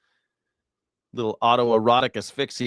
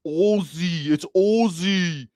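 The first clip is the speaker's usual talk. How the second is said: "Ozzy" said with a long Australian o, higher and slow; mock Aussie saying "It's Ozzy" higher and slow; 6 semitones higher, much slower than usual. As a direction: imitate an Aussie correcting the vowel in "Ozzy". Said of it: "No, mate".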